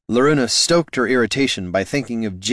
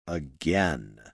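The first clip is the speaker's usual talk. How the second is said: softer, lower flat command; quiet flat repeat; 6 semitones lower, 8 dB quieter than usual. Acted. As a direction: say it flatly, quieter. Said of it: "he repeated".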